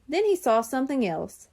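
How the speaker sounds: usual speaking voice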